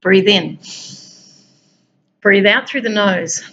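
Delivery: calm breathing instructions with long pauses; guided breathing. Acted. Leads breathing exercise.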